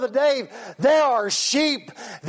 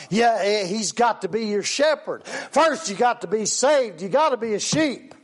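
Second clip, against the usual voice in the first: ordinary talk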